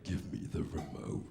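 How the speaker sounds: low, slow demonic demand; an ancient god speaking through his girlfriend; possessed growl. Retold or imitated. Angry girlfriend demanding the remote, low and possessed. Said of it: "starts talking in tongues like".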